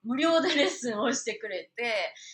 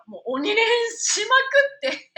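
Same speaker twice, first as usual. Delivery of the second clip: higher, louder, laughing at the end; excited burst; 11 semitones higher, 9 dB louder, faster than usual. Real, not acted. Telling viewers how hard she trained, laughing excitedly. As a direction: boast it, laughing.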